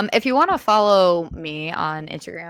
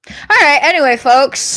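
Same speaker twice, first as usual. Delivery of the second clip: announcer sign-off; 8 semitones higher, 11 dB louder than usual. Real, not acted. Wrapping up the podcast loudly.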